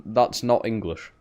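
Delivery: ordinary talk, nothing marked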